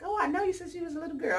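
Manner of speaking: high, fast mock elder voice; older folks talking down. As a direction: imitate the aunties who knew you as a kid. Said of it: "and they be like".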